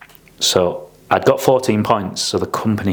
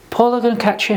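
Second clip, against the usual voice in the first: much higher, exasperated mentor; exasperated warning. Retold or imitated. Mentor warning the apprentice, much higher exasperated warning. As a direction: warn him he's done for.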